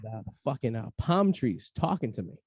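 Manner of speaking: ordinary talk, nothing marked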